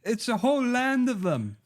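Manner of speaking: high-pitched colonist reporting back; put-on British scout voice; mock British underling. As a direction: pitch it high, tattling to the Prime Minister. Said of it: "'cause they were like".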